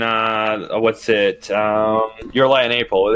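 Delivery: usual speaking voice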